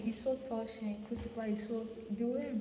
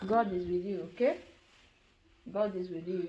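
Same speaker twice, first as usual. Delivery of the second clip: ordinary talk, nothing marked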